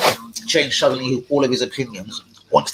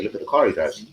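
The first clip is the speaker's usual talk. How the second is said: usual speaking voice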